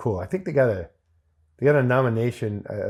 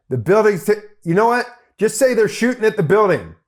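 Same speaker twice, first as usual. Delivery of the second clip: higher, louder excited direction; amused director; 9 semitones higher, 5 dB louder than usual. Retold or imitated. Director laughingly rewrites the line for the extra. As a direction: toss out the new line eagerly. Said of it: "he goes".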